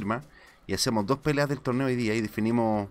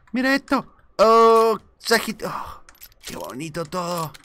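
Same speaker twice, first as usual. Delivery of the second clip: much higher and very loud, thrilled; loot-drop squeal that settles down; excited; 9 semitones higher, 9 dB louder than usual. Real, not acted. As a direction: yelp at the treasure you just found. Finds great loot.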